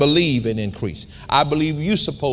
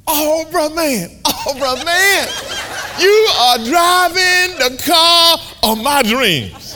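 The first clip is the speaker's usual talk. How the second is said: high, slow, loud hammed-up street guy; a stranger raving about a dream car; excited stranger; 12 semitones higher, 5 dB louder, much slower than usual. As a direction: gush over the car like an awestruck fan. Stranger hails preacher's Rolls Royce.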